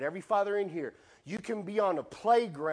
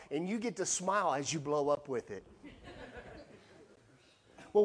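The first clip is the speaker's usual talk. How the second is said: normal talking voice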